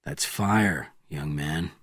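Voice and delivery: softly spoken, dropping low; hushed, understated reply; soft answer. A narrator's reading. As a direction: answer quietly, sinking at the end. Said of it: "answered Bourne softly".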